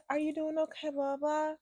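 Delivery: high, very soft mock-sweet voice; mock her gentle concern; mimicked concerned officer. Mimics officer asking after her.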